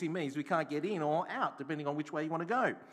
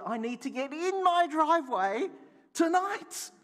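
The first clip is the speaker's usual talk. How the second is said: much higher and louder, pleading; insistent retold plea; 12 semitones higher, 8 dB louder than usual. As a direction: push hard that you need the driveway. His own retold insistence.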